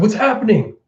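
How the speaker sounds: high-pitched alarmed question; retold startled cry; mock bewilderment. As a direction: ask it in acted confusion and alarm. Samurai bewildered in battle.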